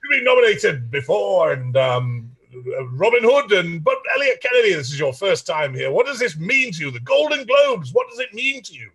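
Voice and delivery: high, swooping red-carpet interviewer voice; hammed-up TV finance reporter, punching the big words; Quest impression. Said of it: "that impression down".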